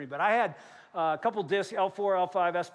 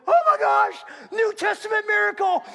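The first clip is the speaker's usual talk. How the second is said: much higher, much louder overjoyed cry; ecstatic joy; 12 semitones higher, 9 dB louder than usual. Retold or imitated. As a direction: shout it out in disbelieving joy. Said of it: "I'm like".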